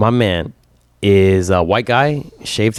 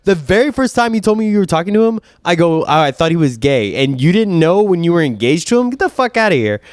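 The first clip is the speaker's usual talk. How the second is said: high-pitched scolding incredulity; exasperated disbelief; 9 semitones higher than usual. Retold or imitated. Scolding his friend for missing the signs, high exasperated disbelief. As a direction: call out the obvious, half laughing.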